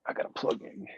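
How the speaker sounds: murmured half-whisper to himself; soft muttered aside; quiet aside. Real, not acted. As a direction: mutter it off-mic while busy. Aside while plugging in battery.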